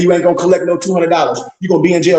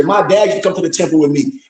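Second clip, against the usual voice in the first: ordinary talk, nothing marked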